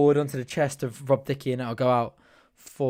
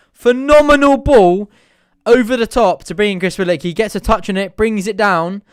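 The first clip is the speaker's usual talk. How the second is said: excited commentary; 6 semitones higher, 9 dB louder than usual. Real, not acted. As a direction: call the through ball in rising excitement.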